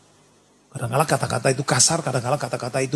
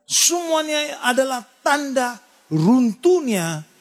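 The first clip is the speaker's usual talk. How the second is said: grieved lament; 8 semitones higher, much slower than usual. Real, not acted. Preacher lamenting the family's collapse.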